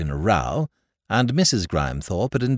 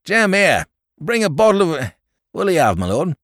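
higher, louder call to the potman; calling to Jem; 9 semitones higher, 4 dB louder than usual. A narrator's reading. Landlord calls the potman for drinks, loud. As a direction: shout for Jem.

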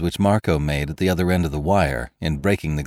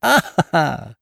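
high, acted triumphant laugh; hammed-up villain's ha-ha; shouting with laughter; 10 semitones higher, 3 dB louder, slower than usual. A narrator's reading. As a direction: crow out a laugh at your victim. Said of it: "shouting with laughter".

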